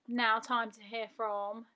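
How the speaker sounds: ordinary delivery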